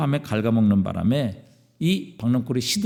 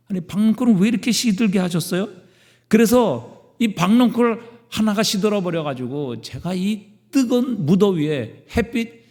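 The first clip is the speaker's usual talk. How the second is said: higher-pitched, mildly angry protest; angry complaint; 7 semitones higher than usual. Acted. Jonah complains to God about the withered plant, mildly angry. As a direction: act out an indignant plea, voice lifted. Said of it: "화를 내며 하나님께 부르짖었어요".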